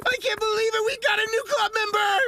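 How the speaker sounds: high, loud joyful shouting; overjoyed. Acted. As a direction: burst out with joy. Overjoyed at new member.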